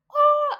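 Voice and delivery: loud, very high excited squeal; fangirl squeal. Retold or imitated. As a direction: let out a starstruck "oh" squeal. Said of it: "I was fangirling".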